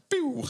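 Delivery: high gunshot pew; pew sound. Acted. Taking the bullet, high pew noise. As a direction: make a toy gun noise.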